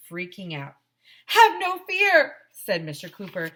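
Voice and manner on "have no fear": high, loud grand hero call; booming high superhero proclamation; heroic superhero voice. A narrator's reading. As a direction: announce yourself like a superhero. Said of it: "This is a job for Cooperman".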